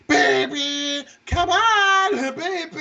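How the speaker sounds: high, overacted pleading; mock bad actress. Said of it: "'Cause she was like".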